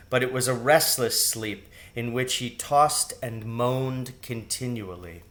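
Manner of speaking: ordinary delivery, nothing marked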